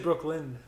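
mimicked "Brooklyn", closed on a hard D; D on the end. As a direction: imitate a misheard Brooklyn with a D after the final n. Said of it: "I thought you said a D after".

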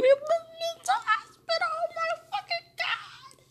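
very high, strained wail; loud, high cry of despair; real distress. Real, not acted. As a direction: voice shoots way up in anguish. Breakdown, fears ending in mental hospital.

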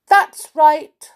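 high, loud retort; stubborn confirmation. Acted. Miss Stubborn answering the unicorn, stubborn. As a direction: agree loudly, not budging.

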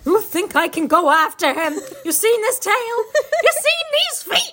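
a beaver pointing at his own body; whiny beaver. Acted. Beaver complaining.

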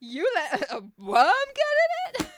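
high, loud incredulous yell; hammed-up scandalised accusation; mock outrage. Outraged painter to Dorian about the worm, mock yell.

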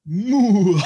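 mock Doctor Evil laugh. Asked for the Doctor Evil laugh, a loud hammed-up high villain cry. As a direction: blurt it out big and hammy, a joke evil cackle. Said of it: "give your best Doctor Evil laugh".

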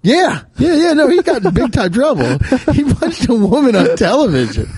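high, excited rush of agreement; incredulous. Real, not acted. Cohost answers whether he got jailed, incredulous. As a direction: exclaim it high, close to laughing. Amazed answer about jail.

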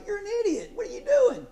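mock Jonah in a high scornful voice; mock scolding. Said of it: "be like".

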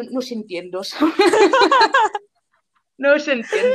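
high laugh then the words through it; real laughter over dad's line. Real, not acted. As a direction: laugh out the quote.

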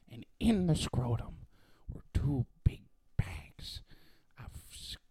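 hushed creepy storyteller whisper; spooky whispered tale. Acted. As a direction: breathe it like a creepy secret.

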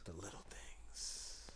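slow whispered echo; whispering. Real, not acted. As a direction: whisper it slowly, testing the title. Echoing title in whisper.

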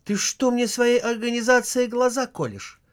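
high, indignant flare-up; wife flares up at husband, high indignation; flaring up. Acted. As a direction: flare up at the reproach. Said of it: "вспылил Ленин".

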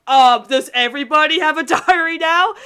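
half-screamed incredulous question; retold exclamation. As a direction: act out your exasperated reaction. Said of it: "I screamed when I saw".

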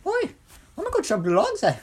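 very high, squeaky Irish-Scottish voice; high-pitched Irish accent. Shown as a demonstration. Wishing they could do the high-pitched ones, squeaky Irish accent. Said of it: "I wish I could do the high-pitched ones".

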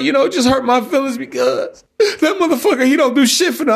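high-pitched imitation of a tearful woman; mock crying. Girlfriend complaining to him about the children's father, mock crying. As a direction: whine it through fake tears. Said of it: "Girl, you stop fucking crying".

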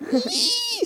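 very high bird squeal; tiny high-pitched bird shriek. A narrator's reading. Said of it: "said the bird".

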